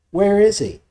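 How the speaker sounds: high, calling crowd asking where he is; searching crowd. Retold or imitated. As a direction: call out like a crowd scanning the feast.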